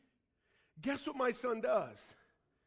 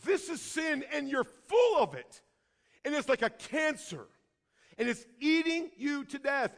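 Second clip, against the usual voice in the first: prophetic wake-up call; 3 semitones higher than usual. Acted. Prophets confronting people with their sin, forceful wake-up call. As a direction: preach it as an urgent alarm. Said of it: "they're meant to be wake-up calls".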